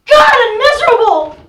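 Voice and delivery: loud anguished sob on the words; sobbing cry. Acted. Irina to her sister Olga, crying out in misery.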